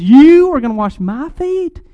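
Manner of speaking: stunned that the king would stoop; disbelieving Peter. Retold or imitated. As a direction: Peter balking at Jesus washing his feet, incredulous. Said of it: "that's why Peter says".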